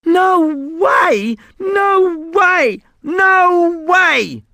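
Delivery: put-on high loud boy shouting; yelling. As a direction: refuse at the top of his voice. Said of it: "Ross started yelling".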